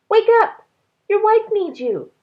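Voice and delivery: high, loud fish voice calling; a magical fish rousing the sleeper; talking fish. A narrator's reading. Fish calling to Ba in the night, high and loud. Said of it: "the fish said".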